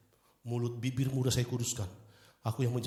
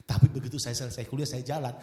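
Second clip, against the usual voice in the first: ordinary talk, nothing marked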